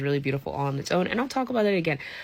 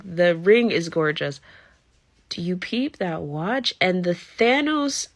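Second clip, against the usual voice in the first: ordinary talk, nothing marked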